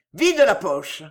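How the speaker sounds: loud curt order; harsh command. Acted. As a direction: bark the order. Foreman orders the girl.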